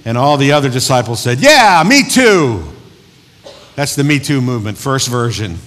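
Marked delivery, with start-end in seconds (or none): 1.4-2.6 high, loud, stretched-out agreement; hammed-up follower jumping on board; eager chiming in. Disciples chime in after Peter.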